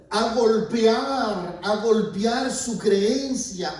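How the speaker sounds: golpear stretched and loud; slow, emphatic preaching. Real, not acted. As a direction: hammer each word slowly. Preacher hammers the point.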